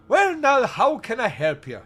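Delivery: hammed-up Irish accent, high, loud opening. Irish reverend welcoming a visitor, put-on Irish accent. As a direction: greet heartily in your best Irish accent. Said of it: "doing my best Irish accent".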